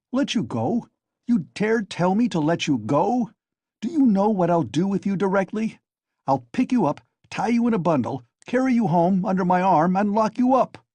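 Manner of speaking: high, loud furious threat; angry threat. A narrator's reading. Razumihin angrily threatens to carry Raskolnikov home, loud and high. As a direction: threaten to haul him home.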